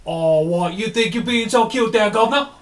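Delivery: high, loud, jeering British yob; British barbarian. Acted. Barbarian mocking the cleric, jeering British voice. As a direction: taunt like a cheeky British lout. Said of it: "they have British level bad teeth".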